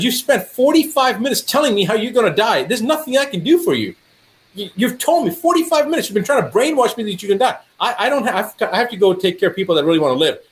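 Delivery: high-pitched, insistent retold dressing-down; exasperated rebuke. Doctor to patient who gave up, exasperated rebuke.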